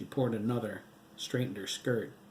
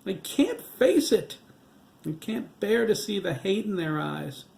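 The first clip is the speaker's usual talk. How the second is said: high, louder sobbing plea; 9 semitones higher, 8 dB louder than usual. A narrator's reading. Widow sobbing to her ghost husband, much higher. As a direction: sob it out, voice breaking high. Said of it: "Nancy sobbed".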